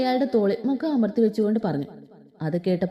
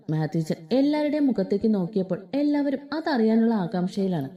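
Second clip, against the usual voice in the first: ordinary delivery, nothing marked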